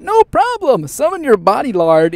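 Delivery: cheerful. Acted. Cheerful answer, big jump.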